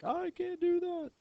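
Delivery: high-pitched wail imitating panicked students; mock freak-out. Teacher imitating students freaking out over remembering freshman year, mock panic. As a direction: whine it out in exaggerated panic. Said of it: "some people start freaking out".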